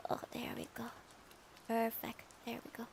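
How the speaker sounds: whispered to herself while fiddling. Real, not acted. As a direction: breathe it out under her breath. Fixing glasses off mic.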